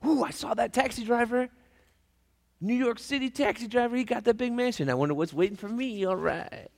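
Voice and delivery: high, eager anticipation; excited pastor. Acted. The pastor in heaven awaiting his mansion, high and eager. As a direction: bounce with expectation. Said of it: "He's excited".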